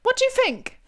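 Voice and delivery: loud, high-pitched mock-British badgering; British accent. Needling Nathan who hates it, loud high British accent. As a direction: pester loudly in a hammed-up British accent. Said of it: "let me know how my British accent is".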